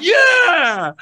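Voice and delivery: high, stretched "yeah"; mock audience roaring approval; imitated crowd cheer. Crowd going crazy for gibberish, mock cheer. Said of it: "And they'd go".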